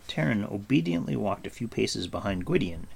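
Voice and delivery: ordinary delivery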